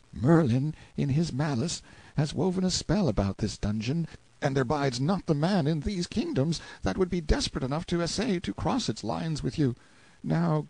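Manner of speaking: put-on high-pitched frightened boy, hushed intent without a true whisper; fearful confiding. A narrator's reading. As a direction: confide dreaded news, mildly apprehensive. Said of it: "told me his fearful news in a whisper".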